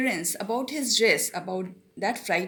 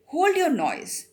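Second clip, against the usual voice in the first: slow, louder, high threatening growl; terrible voice; 7 semitones higher, 4 dB louder than usual. Retold or imitated. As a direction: play the convict scaring the boy. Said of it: "he heard a terrible voice".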